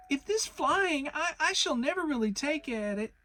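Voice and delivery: jerky Piglet. Acted. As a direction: bounce through it as Piglet. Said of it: "thought jerkily to himself".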